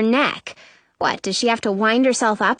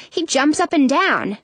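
ordinary delivery

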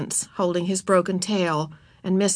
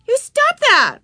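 put-on high, loud indignant girl; shrieked, outraged order; angry; 7 dB louder, slower than usual. A narrator's reading. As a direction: snap it like a cross little girl. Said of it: "she said angrily".

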